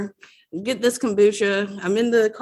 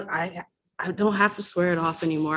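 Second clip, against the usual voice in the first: ordinary talk, nothing marked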